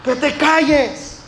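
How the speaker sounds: high, slow, hushing shout; mock angry crowd telling a blind man to hush; crowd yelling to be quiet. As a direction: snap at him to shut up, drawn out. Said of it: "la gente le gritaba y le decía".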